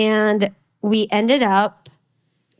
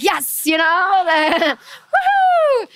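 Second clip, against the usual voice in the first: celebratory whoop, pitch rising high; gleeful, higher-pitched cheer; excited cheering; 7 semitones higher, slower than usual. Retold or imitated. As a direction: whoop it up like great news just landed. Acts out excited reaction to God.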